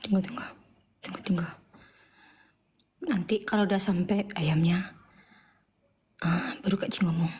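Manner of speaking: hushed aside while waiting for the chicken; whisper. Real, not acted. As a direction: whisper it like a secret to the audience. Waiting quietly for food delivery.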